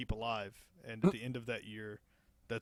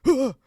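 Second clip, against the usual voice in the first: loud high gasp of fright; startled gasp; 13 semitones higher, 17 dB louder than usual. Retold or imitated. Diver startled underwater, loud high gasp. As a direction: gasp like something grabbed you. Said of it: "And he's like".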